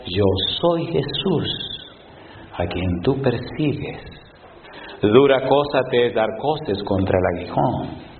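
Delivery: low, slow, solemn heavenly voice; a hushed divine rebuke; voice of Jesus. Acted. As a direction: let it fall slow and grave from above. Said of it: "Y le dijo".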